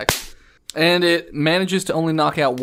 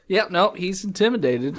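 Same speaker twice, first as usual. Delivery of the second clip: normal talking voice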